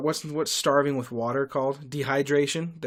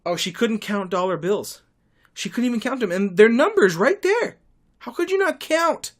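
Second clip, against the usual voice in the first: building from high and loud to a near squeal; incredulous rant climbing higher and higher; exasperated outburst; 8 semitones higher, 6 dB louder than usual. Real, not acted. Ranting about the wife who couldn't count dollar bills, rising exasperated shout.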